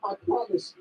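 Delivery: grovel in a rush of panic; squeaky mortified pleading; panicked apology. Acted. Panicked apology after bumping someone.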